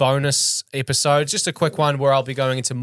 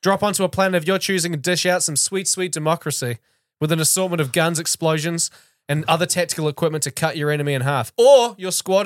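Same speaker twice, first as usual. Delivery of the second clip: punchy review read, a bit higher; 4 semitones higher, 3 dB louder than usual. Acted. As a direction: sell it like a game trailer. Reads scripted review like ad.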